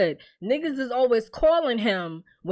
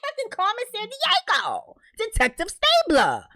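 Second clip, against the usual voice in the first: high, mocking rant about the cop; much higher, teasing comparison to TV detectives; mocking excited; 11 semitones higher than usual. Real, not acted. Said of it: "mocking the detective".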